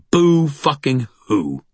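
slow, high, loud mock sympathy; scornful mockery. A narrator's reading. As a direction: draw it out with angry scorn. Angry brother mocks self-pity.

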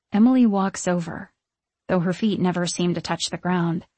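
ordinary delivery

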